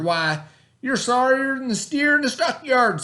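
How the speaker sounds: high, loud jeering; mocking stepbrother. A narrator's reading. Stepbrother mocking Bubba, high and jeering. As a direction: hoot at him. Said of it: "hooted and hollered".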